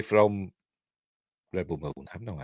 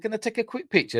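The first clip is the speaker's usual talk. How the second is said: high polite ask; 12 semitones higher, faster than usual. Retold or imitated. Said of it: "and said".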